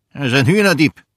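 loud, indignant grumbling; grumpy, forceful complaint; growling. A narrator's reading. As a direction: accuse the fox with a loud growl. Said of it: "knurrte Gustafsson".

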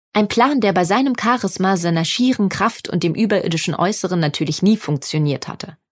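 ordinary delivery, nothing marked

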